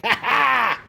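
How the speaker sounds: high mock gleeful cackle; hammed-up victory laugh; triumphant laugh. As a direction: laugh like a man flooring the throttle. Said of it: "he's like".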